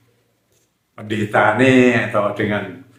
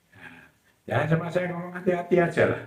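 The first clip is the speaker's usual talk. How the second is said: usual speaking voice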